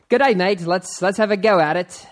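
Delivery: loud, hammed-up Australian accent; a clumsy Aussie greeting, playing it up; a bad Australian accent, hammed up for a mate from Sydney. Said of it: "the Australia greeting".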